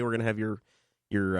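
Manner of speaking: normal talking voice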